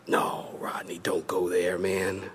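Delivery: low, hushed whisper; whispered objection. Acted. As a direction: whisper it like a wary listener's plea. Voicing a listener's objection to him.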